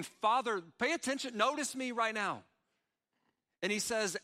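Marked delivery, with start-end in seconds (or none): 0.0-2.4 urgent plea. Retold or imitated. David pleading to God.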